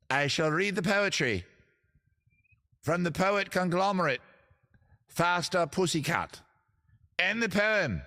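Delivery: grand, hammed-up poetry voice; over-the-top British poet. Host finding his poet character for the show, over-the-top poetry voice. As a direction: declaim it like a pompous British poet. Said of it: "my poetry voice".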